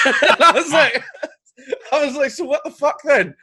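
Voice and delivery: squeaky, high laughing outrage; laughing disbelief. Retold or imitated. Comedian retelling his reply to the festival, laughing high.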